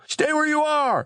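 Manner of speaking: high, sustained call at narrator's volume; urgent command, pitched right up; yelled order. A narrator's reading. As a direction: yell it high but contained. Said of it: "Frank yelled".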